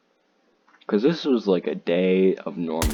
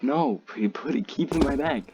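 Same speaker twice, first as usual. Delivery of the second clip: higher-pitched, quicker retold insistence; insistent refusal; 7 semitones higher than usual. Telling a friend to keep the vape bagged, retold insistent refusal. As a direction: push the refusal, pitch climbing as you repeat it.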